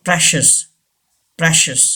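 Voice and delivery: PRECIOUS as PRESH-us; two syllables in "precious", short E; correct pronunciation. Shown as a demonstration. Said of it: "correct pronunciation". (precious को "प्रेशस" बोलें)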